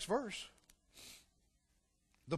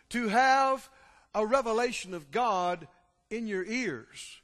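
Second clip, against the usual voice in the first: ordinary talk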